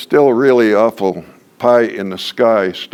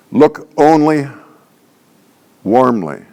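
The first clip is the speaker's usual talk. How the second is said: "Look only" raised, long pause, then "warmly" falling; emphatic statement; 2 semitones higher than usual. Shown as a demonstration. Trainer stating the second pillar, "Look only warmly" emphatic with a pause before "warmly". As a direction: set "warmly" apart as its own statement. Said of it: "emphatic, em-, uh, statements".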